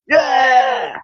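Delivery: high, drawn-out yeah; a retold whoop of yes; excited yeah. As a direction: cheer it like a proud fan. Enthusiastic reply in retold banter.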